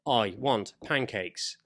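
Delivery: slow, evenly spaced synthetic-voice imitation; speech device voice. Showing how the child's device talks, robotic imitation. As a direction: say it like a basic text-to-speech device. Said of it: "Does it sound like Stephen Hawking's?".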